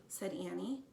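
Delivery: ordinary delivery, nothing marked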